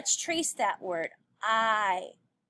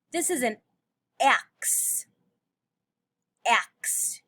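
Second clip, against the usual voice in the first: ordinary talk, nothing marked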